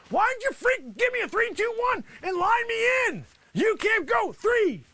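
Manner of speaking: high, indignant shouting; worked-up, high-pitched complaint; exasperated scolding. Acted. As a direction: chew out the director for a botched cue. Scolds director for botched live cue.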